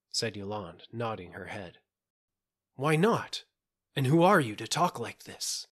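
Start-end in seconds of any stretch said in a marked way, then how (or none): 2.7-5.7 higher, louder challenge to a stranger; indignant challenge. A narrator's reading. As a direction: demand an answer, affronted. Gervais challenges Yolande's dark warning.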